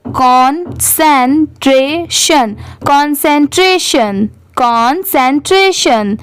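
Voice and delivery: slow, drawn-out blend of concentration. Shown as a demonstration. Teacher blends the word slowly for her students. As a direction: stretch out concentration syllable by syllable. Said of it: "अब चारों मिलाएंगे".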